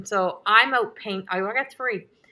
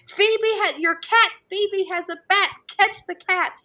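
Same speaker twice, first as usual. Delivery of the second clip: much higher, loud urgent calling; panicked yell; 11 semitones higher, 3 dB louder than usual. Retold or imitated. Wife shouting to her husband that the cat has a bat, urgent yell. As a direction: yell the news across the house in a panic. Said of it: "So I'm like".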